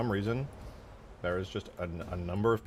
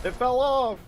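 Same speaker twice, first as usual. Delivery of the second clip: much higher and louder, delighted; excited shout; 8 dB louder, slower than usual. Real, not acted. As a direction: cheer the part falling off. Reacting to game event.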